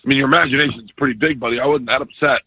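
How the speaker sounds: ordinary delivery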